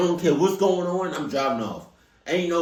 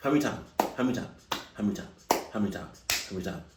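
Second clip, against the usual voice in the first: fading repetition; 9 semitones lower, 7 dB quieter, much faster than usual. Real, not acted. Repeating, trailing away.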